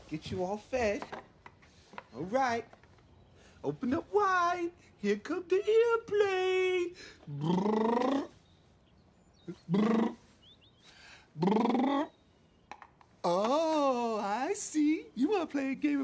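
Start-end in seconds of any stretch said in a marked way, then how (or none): 3.6-6.9 put-on baby talk. Feeding baby, slow. 7.4-12.1 buzzing lips like a plane; airplane noise. Acted.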